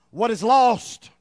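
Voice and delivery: slow, loud, weighty preaching; emphatic. Acted. Preacher stresses the point to the church, slowly and loudly. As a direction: drive home each word slowly.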